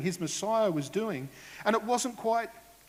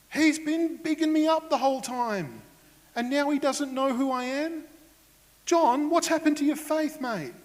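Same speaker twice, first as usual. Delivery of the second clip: indignant Jesus; 7 semitones higher than usual. Pretend. Jesus pretending to rant about John's doubt, indignant and rising. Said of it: "He didn't get all indignant and go".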